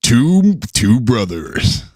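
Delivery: slow, dramatic trailer narration; movie trailer voice. Pretend. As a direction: draw it out like an epic film ad. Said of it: "old movie trailer voice".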